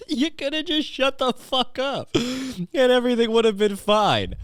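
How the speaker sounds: high, slow words through laughter; high laughing talk. Real, not acted. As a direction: still giggling at the joke. Laughing while talking.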